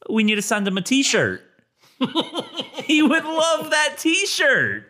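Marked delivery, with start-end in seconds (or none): 2.0-4.8 high, slow, laughing through the words. Real, not acted. Co-host laughs through the joke, high and slow. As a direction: say it while laughing hard.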